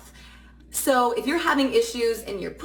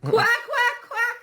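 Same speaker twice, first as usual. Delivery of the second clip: duck quack; 13 semitones higher, much slower than usual. Shown as a demonstration. Coach demonstrating duck quacks for twang. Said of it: "sing it like you're a duck".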